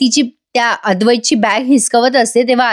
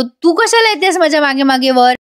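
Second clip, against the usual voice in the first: angry; 5 semitones higher than usual. A narrator's reading. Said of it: "अद्वैत चिडत म्हणतो".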